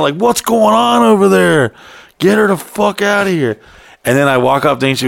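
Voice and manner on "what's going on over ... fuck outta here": freaking out. Retold or imitated. Man wanting the visitor gone, freaking out. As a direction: demand answers in retold alarm. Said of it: "I'm, like, freaking out".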